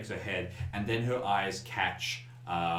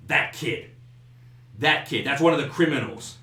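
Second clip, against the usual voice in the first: raised, higher accusing voice; indignant, louder accusation; glaring accusation; 5 semitones higher, 6 dB louder than usual. Acted. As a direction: point out the culprit angrily. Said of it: "like, glares and goes".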